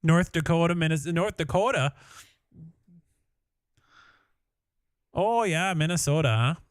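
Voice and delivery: put-on loud, playful North Dakota-Minnesota accent. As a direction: put on an Upper Midwest accent, long rounded O. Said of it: "Combined with your Ohio accent".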